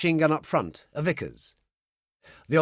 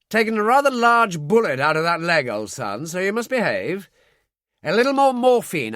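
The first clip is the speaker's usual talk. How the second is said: louder, higher jovial officer; hearty colonel; 4 semitones higher, 4 dB louder than usual. A narrator's reading. Colonel bantering with the patient, bluff and loud. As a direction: bluff army doctor cheer.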